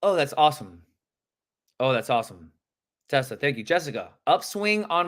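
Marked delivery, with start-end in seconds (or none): 0.0-2.5 downswing tone. Shown as a demonstration. Coach showing how not to take rejection, downswing tone. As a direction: let down, pitch sinking as it ends. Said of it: "Downswing is".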